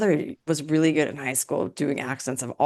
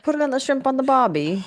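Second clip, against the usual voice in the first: higher-pitched mock Australian accent; Australian accent attempt; 7 semitones higher than usual. Shown as a demonstration. Co-host tries a stock phrase, hammed-up Australian accent. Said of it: "do an Australian accent".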